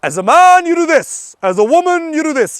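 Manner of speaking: pompous rule-giver; mock dictating rules. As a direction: lay down the law like a pompous patriarch. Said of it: "I have no interest in saying".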